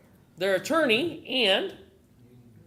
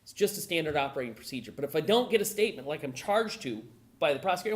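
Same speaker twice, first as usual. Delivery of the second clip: reassuring officer; 6 semitones lower than usual. Retold or imitated. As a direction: talk him down gently like a cop. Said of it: "he may look at Wayne and go".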